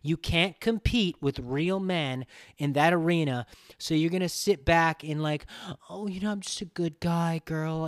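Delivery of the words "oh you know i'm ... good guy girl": mock nice guy. Mocking male feminist.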